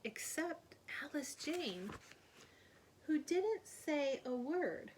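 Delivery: slow down and hush for the ending; hushed storyteller. A narrator's reading.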